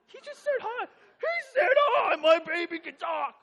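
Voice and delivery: high, loud mock-hysterical screaming; ecstatic disbelief. Parents amazed that their newborn spoke, hysterically excited. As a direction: build to a drawn-out, tearful shriek.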